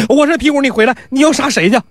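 尖高的女声急着叫住他; 拔高嗓门像媳妇追着喊; 妻子喊丈夫. Acted. 皮五妻子喊住丈夫. (媳妇急忙叫住要去杀人的丈夫)

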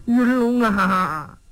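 crying to his sworn brother that everyone died; weeping. Acted. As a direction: draw each word out through the tears. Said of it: "贾明又哭上了".

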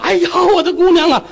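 a high, loud mock wail for her daughter; wailing mother. Mother wailing over her daughter's body in court. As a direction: cry out over the dead girl. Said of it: "这姑娘他妈哭坏了".

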